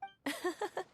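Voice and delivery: short, high giggle; little burst of amusement; amused giggle. Acted. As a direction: giggle lightly at a friend's impression. Amused by Paimon's impression.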